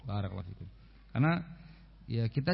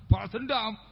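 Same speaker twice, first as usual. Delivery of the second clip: ordinary talk, nothing marked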